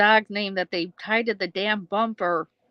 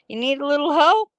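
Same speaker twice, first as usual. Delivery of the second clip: quoting a character; 8 semitones higher, 5 dB louder than usual. Retold or imitated. Quoting the cousin.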